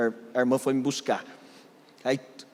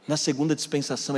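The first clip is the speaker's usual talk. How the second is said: ordinary talk, nothing marked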